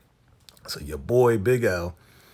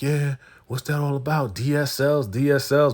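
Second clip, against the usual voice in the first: higher, teasing mock voice; 6 semitones higher, 3 dB louder than usual. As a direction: taunt her like a schoolyard bully. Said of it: "making fun of her".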